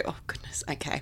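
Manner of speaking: whispered, breathy nerves; nervous whisper. Retold or imitated. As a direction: whisper it, bracing yourself. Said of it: "lay there and go".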